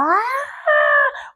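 long, high-pitched labor scream; deranged scream. Retold or imitated. Mother re-enacting her screams in labor, long high scream. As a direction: imitate screaming like a madwoman in childbirth. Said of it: "yo gritaba como una desquiciada".

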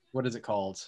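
ordinary talk, nothing marked

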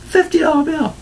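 high, squeaky disbelief at "Fifty dollar bill"; stunned find; amazed. Retold or imitated. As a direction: gasp out the amount in shock. Amazed at finding money.

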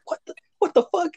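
very high, incredulous squeak; retold bafflement. Newcomer after a weird salute, baffled. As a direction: replay your disbelief way up high.

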